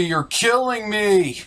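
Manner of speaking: ordinary delivery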